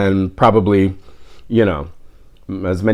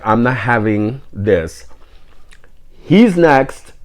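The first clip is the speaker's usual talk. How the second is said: imitated defiant grandmother; 3 dB quieter, slower than usual. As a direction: imitate a grandmother putting her foot down. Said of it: "somebody's grandmother was like".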